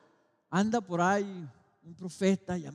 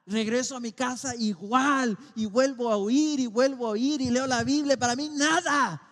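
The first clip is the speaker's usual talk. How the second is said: sube de tono y volumen hasta gritar; predicación creciente; 7 semitones higher, 9 dB louder than usual. Acted. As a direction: empieza contenido y termina proclamando. Preaches, building intensity. (predicación que crece hasta el clamor)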